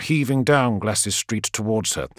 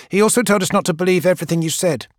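put-on quick, higher young voice rushing it out; quick young woman; 7 semitones higher, faster than usual. Gang girl passing on Sinderman's warning to Keeler, rushed. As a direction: blurt it out fast.

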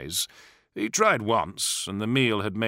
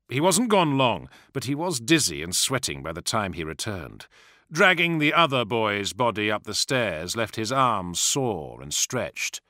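ordinary delivery

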